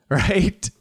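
usual speaking voice

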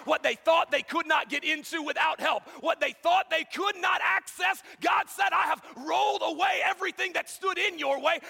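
loud, high, climbing sermon shout; full force preaching. Acted. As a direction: preach it out to the back row.

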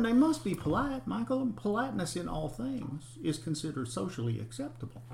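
sweet, proper Southern lady lecturing up high; prim Southern lady. Retold or imitated. Imitating polite Southern women for his friend Michael, high and prim. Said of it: "Can you still do the accent?".

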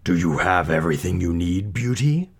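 gruff monster asking politely; rough creature voice, lifting at the end; beast's voice. A narrator's reading. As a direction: growl a courteous question. Beast voiced addressing Beauty.

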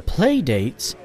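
lightly loaded stress on "play dates"; suggestive emphasis. Acted. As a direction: lean knowingly on "play dates". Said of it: "emphasizing the word in such a way".

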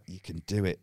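low, hushed pep talk; quiet self-encouragement. Retold or imitated. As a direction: coax yourself softly under your breath. Pep talk to himself before going in.

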